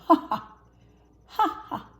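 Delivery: high loud cackling; loud mocking hyena cackle; hyena laugh. A narrator's reading. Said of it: "replied the elephant skull".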